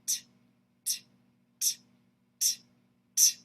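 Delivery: bare t, t, t, t clicks of the tongue; t sounds. Shown as a demonstration. Teacher showing the tongue stroke behind each note. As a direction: tap out a dry t on each beat. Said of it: "I'm doing that by going t".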